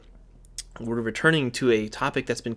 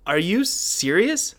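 slow, higher-pitched playful incredulity; mock disbelief; 6 semitones higher, 4 dB louder, much slower than usual. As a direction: draw it out as if you truly can't believe it. Playing shocked at newer consoles existing.

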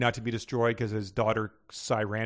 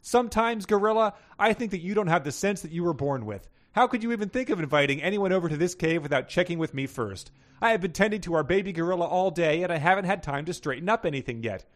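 higher-pitched, mildly raised scolding; narrated scolding yell; 6 semitones higher, 4 dB louder than usual. A narrator's reading. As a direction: read a wife telling off her husband. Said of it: "Glendra Gorilla yelled out".

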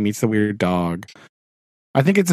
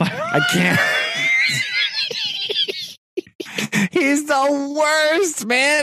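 very high, strained, laughing rant; exasperated squeal; 13 semitones higher, slower than usual. Real, not acted. Exasperated rant about hated character.

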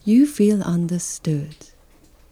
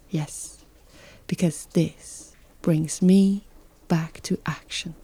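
whispered punchline; breathy hushed ending; 4 dB quieter than usual. Real, not acted. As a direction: drop to a whisper for the last words. Hushed emphatic aside.

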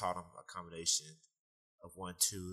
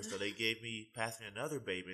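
usual speaking voice

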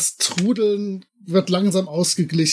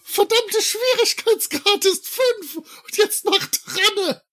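high, slow squeaky helium voice; 10 semitones higher, slower than usual. Acted. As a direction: panic cries on helium. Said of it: "dieses Helium tödlich".

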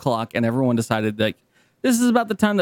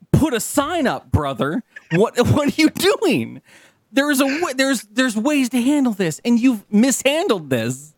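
scolding the valet; 6 semitones higher than usual. Pretend. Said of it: "I'm like".

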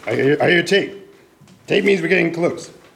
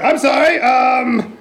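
high, loud flustered apology; loud, high "I'm sorry"; 8 semitones higher, 5 dB louder, slower than usual. Real, not acted. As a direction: blurt out the apology. Host caught using a name.

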